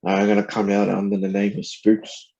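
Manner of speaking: normal talking voice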